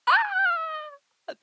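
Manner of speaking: high, loud playful shriek; mock shriek. Vlogger shrieking after confessing about coding homework. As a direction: shriek in hammed-up panic.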